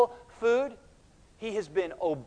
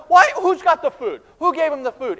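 high, loud, insistent questions; agitated questioning; 8 semitones higher, 10 dB louder than usual. Acted. Disciples ask each other about food, agitated questioning. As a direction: press everyone for an answer.

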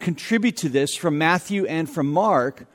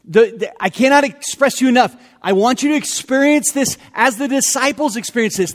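usual speaking voice

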